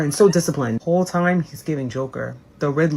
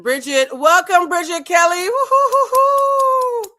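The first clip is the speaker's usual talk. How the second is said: very high, slow drawn-out whoop; excited welcome cheer; 13 semitones higher, slower than usual. Real, not acted. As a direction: hoot to welcome Bridget on stage. Welcoming guest on stage.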